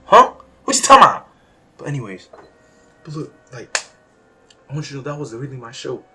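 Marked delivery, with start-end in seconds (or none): 0.0-1.3 loud defiant rant at a heckler. Acted. Vlogger defending his rambling, loud defiant shout. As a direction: shout back at the doubters. Arguing with imagined critic.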